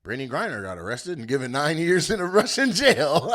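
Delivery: giggling through the headline; can't keep a straight face; laughing. Real, not acted. Comic starting the Griner story, laughing.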